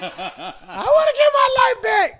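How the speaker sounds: sob-shout it, hammed up; crying out. Retelling his crying in church, mock wail. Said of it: "I can't stop crying".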